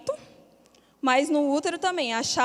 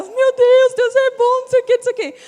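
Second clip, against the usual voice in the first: high, loud, tearful praise; crying with relief; 11 semitones higher, 8 dB louder than usual. Retold or imitated. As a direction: cry out thanks, much higher and louder. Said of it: "aí eu chorava". (chorando de alegria, voz aguda e alta)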